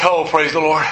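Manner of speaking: laughing out praise the Lord with relief; relieved laugh. Retold or imitated. Pastor stopped at the cliff edge, laughing with relief. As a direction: sigh of relief through a laugh. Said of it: "he leans back".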